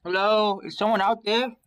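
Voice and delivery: loud high call. Acted. Raven calling out for someone from behind the door. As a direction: shout out to anyone listening.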